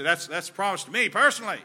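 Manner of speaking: mocking "promise to me personally"; mock self-centred claim. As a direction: parody someone claiming "me" personally. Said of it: "Many people will say".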